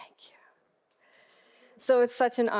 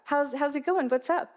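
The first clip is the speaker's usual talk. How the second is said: bright and much higher, casual and upbeat; breezy cheerful reply; 6 semitones higher than usual. Retold or imitated. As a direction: answer brightly, no idea what's coming. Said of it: "And I was like".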